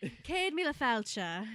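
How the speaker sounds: Céad Míle Fáilte spoken as Irish; Irish Gaelic phrase. Shown as a demonstration. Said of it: "Say this in a Gaelic".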